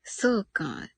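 usual speaking voice

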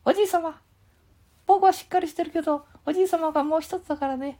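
put-on very high squeaky child voice; high boy puppet butting in; Ken-chan puppet voice. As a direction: pipe up high like a cheeky little boy. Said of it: "みたいな感じでやってます".

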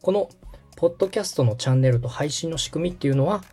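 ordinary talk, nothing marked

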